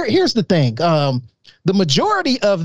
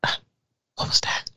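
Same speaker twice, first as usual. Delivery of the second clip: hissed stage whisper; whisper yelling; 9 dB quieter than usual. Real, not acted. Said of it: "Why are you whispering?".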